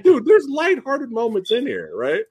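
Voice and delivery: high mock exclaim; high playful retort; imitating himself. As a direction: pitch it up as if pleading a point. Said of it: "I'm like".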